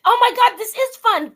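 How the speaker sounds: excited gush. Real, not acted. Host greeting her new guest, excited gush. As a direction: gush like you can't believe your luck.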